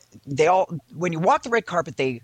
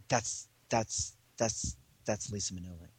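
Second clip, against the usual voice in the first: low, soft, halting awe; stunned murmur; 8 semitones lower, 11 dB quieter than usual. Retold or imitated. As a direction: stammer it under your breath. Manager stunned, murmurs confirmation.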